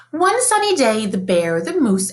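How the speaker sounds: ordinary delivery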